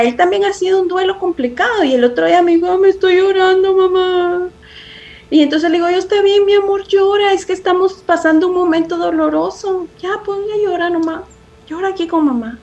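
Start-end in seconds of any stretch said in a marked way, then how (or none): 2.8-4.5 put-on higher, childlike voice of her son; lightly voicing a small boy's tears; little boy crying. Son telling mom he is crying, in a lightly childlike voice. 6.0-12.6 put-on higher, gentle soothing of a child; comforting. Mother consoling her grieving son, tender and higher.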